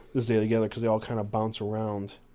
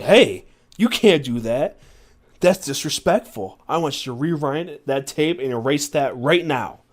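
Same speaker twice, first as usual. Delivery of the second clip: louder, higher indignant telling-off; angry; 4 semitones higher, 8 dB louder than usual. Acted. Man confronting a stranger filming his friend, angry. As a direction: scold with rising outrage. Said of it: "I was angry".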